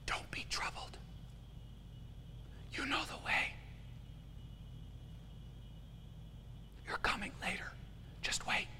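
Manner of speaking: a soft breathy whisper with no voice at all; Spirit's whisper. Acted. The Holy Spirit whispers comfort to the anxious listener, whispering. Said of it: "whispers to you".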